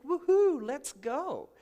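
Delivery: high, loud woohoo burst; gleeful whoop. Retold or imitated. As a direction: cheer like you're finally free to go. Mom free to travel.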